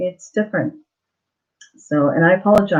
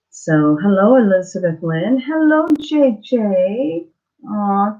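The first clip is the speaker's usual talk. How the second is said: usual speaking voice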